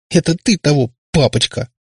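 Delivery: sobbing retort. A narrator's reading. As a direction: throw it back at him through a sob. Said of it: "всхлипнула Катерина".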